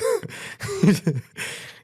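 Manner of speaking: real laugh breaking out high; high burst of laughter. Real, not acted. Friend cracking up while retelling the tour prank, real laughter. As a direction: let a squeaky laugh burst out.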